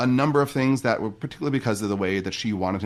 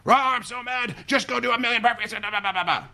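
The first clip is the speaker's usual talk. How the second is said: mock angry outburst; 13 semitones higher than usual. Shown as a demonstration. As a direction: rant like a fed-up parent who has lost it. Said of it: "there's two ways I can assign burpees".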